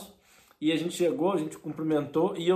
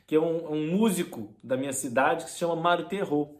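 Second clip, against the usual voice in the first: ordinary talk, nothing marked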